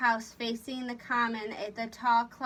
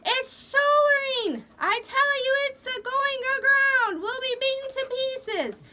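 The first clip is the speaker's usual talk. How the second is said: panicked scream; 14 semitones higher, 8 dB louder than usual. A narrator's reading. Terrified sailor warning they will run aground, screaming. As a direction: scream in terror of wreck. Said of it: "Corey screamed".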